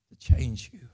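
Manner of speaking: breathy dramatic whispered close; hushed whisper of the blood's power. Acted. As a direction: drop to a whisper for the punch. Quote ends in sudden whisper.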